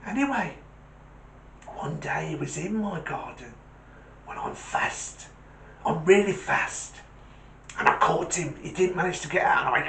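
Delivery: lightly voiced small dog; little terrier confessing. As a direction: tell it as a mildly agitated little dog. Terrier tells his story.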